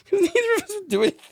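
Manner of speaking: high laughing voice, slowed. Real, not acted. Retelling the snake panic, high and laughing. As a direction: squeak it out through laughter.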